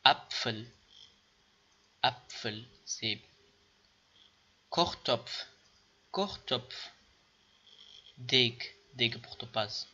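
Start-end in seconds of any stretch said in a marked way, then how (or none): 0.0-2.6 اپفل said AP-FEL with pf blend; teacher drill; pf sound demo. Shown as a demonstration. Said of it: "صدای مشترک بین پ و ف". 4.7-6.9 کوختوپف said KOKH-TOPF ending in pf; teacher drill; pf sound demo. Shown as a demonstration. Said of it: "صدای مشترک بین پ و ف".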